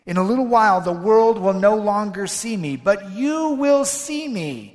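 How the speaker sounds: forceful preacher reading; scripture proclamation. Acted. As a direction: proclaim Jesus' words to the congregation.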